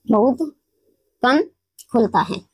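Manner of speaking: बहुत ... कम ... खुलता है said word by word with long pauses, falling low at the end; slow spaced demo. Shown as a demonstration. Teacher slowly spells out for students that the mouth barely opens. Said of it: "जैसे".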